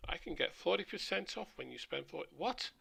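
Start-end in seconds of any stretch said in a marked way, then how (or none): 2.4-2.7 surprised. Real, not acted. Reacting to a pizza deal.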